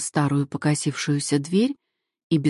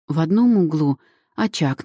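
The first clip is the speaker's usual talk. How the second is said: plain reading voice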